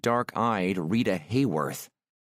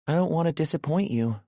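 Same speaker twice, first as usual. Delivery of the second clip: put-on higher, bright-eyed nervous young woman; voiced shy woman; 8 semitones higher, faster than usual. A narrator's reading. Aurora agrees to go up and shower, nervously higher. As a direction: lift the pitch for shy Aurora.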